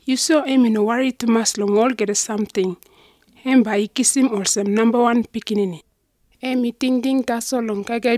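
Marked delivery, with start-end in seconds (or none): none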